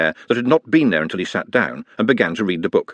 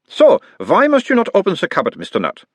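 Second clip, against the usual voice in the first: put-on higher, louder doctor's voice with a light Uberwald accent; mild Uberwald accent; 10 semitones higher, 4 dB louder than usual. A narrator's reading. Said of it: "mild Uberwaldian accent".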